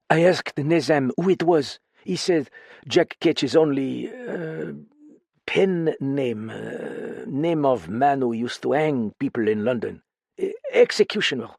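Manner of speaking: frightened foreign woman confiding in a friend; lightly French-tinged voice, uneasy and pleading; accented woman character. Acted. Same character voice.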